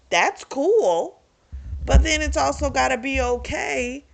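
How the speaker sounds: defiant outburst. Retold or imitated. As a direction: fire it back, voicing her defiance. Voicing her defiant retort.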